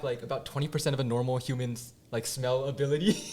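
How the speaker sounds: ordinary delivery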